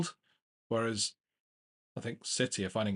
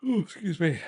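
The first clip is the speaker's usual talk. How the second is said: yawning; 6 semitones higher, 4 dB louder than usual. Real, not acted. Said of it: "this is where you can tell I'm yawning now".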